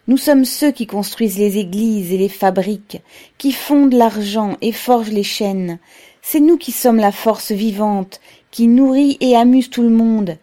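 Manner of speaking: loud, impassioned oratory; rousing speech. A narrator's reading. Pavel tells the workers they are the living force, rousing and loud. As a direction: address a crowd with conviction.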